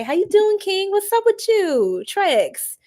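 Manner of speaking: very high singsong hello; gushing greeting. Real, not acted. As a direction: coo a hello way up high, drawing out the end. Greeting a viewer joining the stream.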